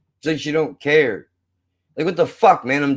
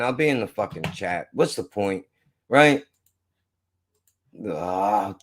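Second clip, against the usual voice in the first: usual speaking voice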